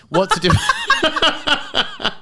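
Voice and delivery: breaking up mid-question; words dissolve into laughter; laughing. Real, not acted.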